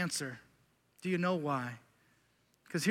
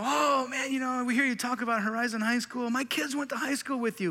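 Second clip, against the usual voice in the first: higher-pitched imitation of an eager congregant; chatty congregant; 6 semitones higher than usual. Churchgoer coming up to the pastor about high school, imitated chatty voice. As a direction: imitate a churchgoer making friendly small talk, pitched up. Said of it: "you come up and you go".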